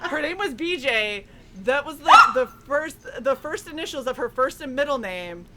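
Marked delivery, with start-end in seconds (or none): 0.1-2.2 high giggling through the words; laughing hard while telling the punchline. Real, not acted. Laughing while telling friends about a classmate's nickname.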